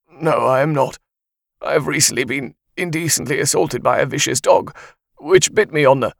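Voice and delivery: loud, aggrieved complaint; indignant reporter. Acted. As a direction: protest like a wronged man. Bitten reporter complains on air.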